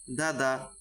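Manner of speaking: দাদা said short, without dragging either vowel; short quick vowels. Shown as a demonstration. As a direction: keep both syllables of দাদা brief. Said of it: "আমি উচ্চারণগুলো তাড়াতাড়ি করেছি".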